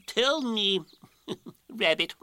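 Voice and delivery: slow pompous owl with a chuckle; self-important storybook Owl; ponderous Owl, having an idea. Acted. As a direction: address Rabbit as a learned owl.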